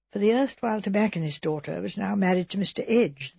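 plain reading voice

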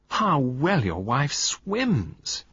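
high, slow, sweet drawl; Valentine voiced. Valentine praising the wife with slow sweet drawl.

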